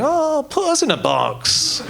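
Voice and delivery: high, drawn-out pleading whine; hammed-up begging to be included; mock pleading. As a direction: plead slowly in a high, put-on voice. Neutral people pleading to be labelled.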